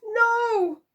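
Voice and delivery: very high, loud scream of no; shrieking mother; horrified cry. A narrator's reading. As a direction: cry out in horror. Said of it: "cried Isabel in horror".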